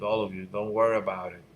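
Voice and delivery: ordinary talk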